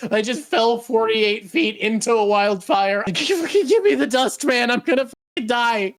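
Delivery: half-laughing mock panic, begging for dust; Guts panicking. Guts begging for fairy dust after the fall, laughing. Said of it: "him being like".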